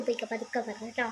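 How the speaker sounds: hushed shooing. Acted. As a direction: hurry them out in a hush so the baby sleeps.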